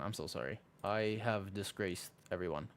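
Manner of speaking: usual speaking voice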